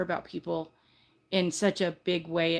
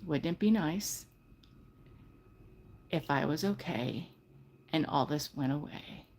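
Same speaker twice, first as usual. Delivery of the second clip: soft wishful mantra; 3 semitones lower, 6 dB quieter than usual. Shown as a demonstration. For a panicking guest, soft calming mantra. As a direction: breathe it out softly. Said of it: "That's what you need to say".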